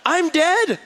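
high, loud panicked cry; mock panic. Little boy thinks he's in trouble, high panicked cry. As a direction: squeal it like a doomed little kid. Kid fears punishment.

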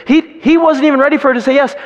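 ordinary delivery

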